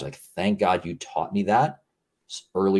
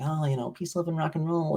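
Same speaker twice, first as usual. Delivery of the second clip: higher-pitched, lightly mocking peace-and-love voice; mock chummy hippie talk; 9 semitones higher, 3 dB quieter than usual. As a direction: put on an over-friendly, high airy-headed tone. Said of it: "I'm not, like, talking like".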